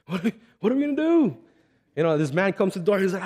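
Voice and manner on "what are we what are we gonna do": high, quick mock panic; acted-out nervous fluster; flustered student. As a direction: imitate a rattled student, pitched up and hurried. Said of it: "it was embarrassing to me".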